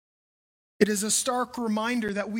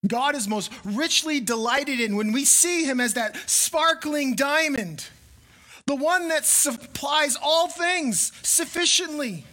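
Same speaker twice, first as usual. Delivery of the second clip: higher, louder preaching climax; 5 semitones higher, 4 dB louder than usual. Acted. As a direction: build toward the sparkling diamond.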